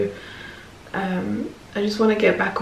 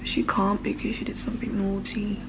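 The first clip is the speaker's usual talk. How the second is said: whispering; 2 semitones lower, 10 dB quieter than usual. Real, not acted. Secret aside about the dog.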